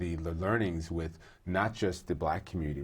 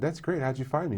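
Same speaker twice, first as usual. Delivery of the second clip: much higher, a bit louder imitation of his own surprise; mock surprised reply; 9 semitones higher, 4 dB louder than usual. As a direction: replay the surprise, pitched well up.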